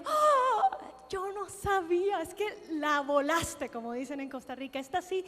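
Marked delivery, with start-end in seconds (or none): 0.0-3.7 high, slow astonished gasp then wonder; a delighted gasp at a surprise; amazed. Retold or imitated. Woman retelling being surprised by God, astonished and slow.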